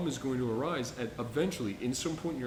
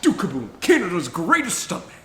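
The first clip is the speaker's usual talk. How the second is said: higher, louder hammed-up Duke Kaboom voice; Keanu Reeves as Duke Kaboom; 6 semitones higher, 10 dB louder than usual. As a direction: announce yourself like a proud stunt daredevil. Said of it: "where he's like".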